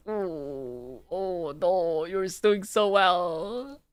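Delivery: soft, drawn-out coo, rising in pitch; hushed, stretched-out playful praise. Acted. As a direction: coo it slowly and softly. Teasing aside during the date review.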